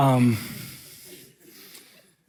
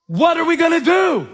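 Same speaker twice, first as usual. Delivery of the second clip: confrontational challenge; 12 semitones higher than usual. Retold or imitated. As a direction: call it out, squaring up to fight. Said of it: "I said".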